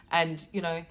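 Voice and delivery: ordinary talk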